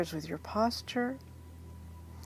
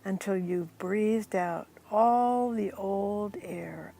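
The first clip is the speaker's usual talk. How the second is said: softly guiding breath by breath toward sleepiness; guided hypnosis voice; 5 dB quieter than usual. Acted.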